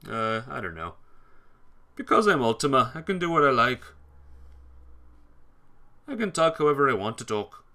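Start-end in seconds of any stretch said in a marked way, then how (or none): 1.9-7.6 cocky. Real, not acted. Defends talking in third person.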